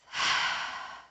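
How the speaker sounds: long audible breathy sigh out; open-mouth exhale. Shown as a demonstration. Teacher showing students an open-mouth exhale, audible sigh. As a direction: let the breath rush out through an open mouth. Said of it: "Exhale through the mouth".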